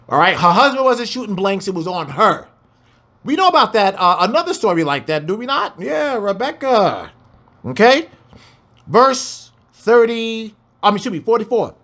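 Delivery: usual speaking voice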